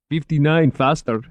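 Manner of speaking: lightly voiced Middle Eastern accent; a driver insisting, Middle Eastern accent; hint of a Middle Eastern accent; thick Middle Eastern accent. A narrator's reading. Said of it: "in a thick Middle Eastern accent".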